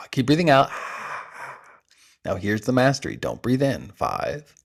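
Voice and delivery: soft, hushed breathing-coach voice with long pauses; guided breathwork. Acted. Coach counts the listeners through breath holds, soft and hushed. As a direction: guide them gently through each hold. Leads a timed breath exercise.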